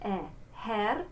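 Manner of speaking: "e, Herr" with the vowel of bed; open E. Shown as a demonstration. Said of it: "what we call the open E".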